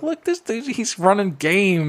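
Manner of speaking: high, slow amused exclaim; amused disbelief. Retold or imitated. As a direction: marvel at the kid's game. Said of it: "I'm like".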